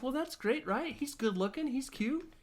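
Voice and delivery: very high, bubbly teacher voice; chirpy gym teacher. Retold or imitated. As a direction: gush it like an overeager coach. Voicing perky gym teacher.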